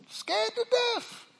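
very high strained cry; high preaching wail. Real, not acted. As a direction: let it break high on scared. Preacher cries out the fear.

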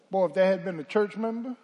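ordinary talk, nothing marked

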